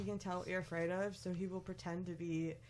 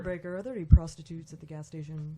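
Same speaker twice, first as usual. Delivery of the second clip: over walkie talkie; 10 dB louder, faster than usual. Pretend. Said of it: "Little walkie-talkie thing".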